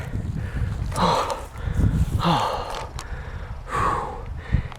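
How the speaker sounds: long winded exhale, out of breath; heavy panting exhale. Real, not acted. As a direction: blow out a long tired breath. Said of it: "Catch my breath".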